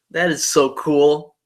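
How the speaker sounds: usual speaking voice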